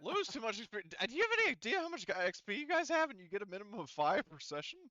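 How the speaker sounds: high, loud incredulous disbelief; mock outrage. Real, not acted. Game master scoffing at players whining about lost XP, incredulous.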